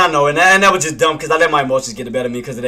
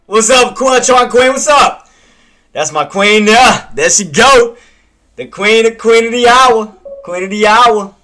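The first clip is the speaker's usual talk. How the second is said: excited greeting; 7 semitones higher, 7 dB louder, slower than usual. Real, not acted. Greets a viewer warmly.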